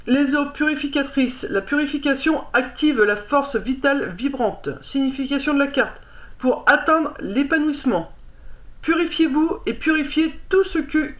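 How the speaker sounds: lire le livret posément, un peu plus haut que la parole; lecture à voix haute. Acted. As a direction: read out a card's message clearly, phrase by phrase. Reads card booklet aloud. (lecture appliquée à voix haute)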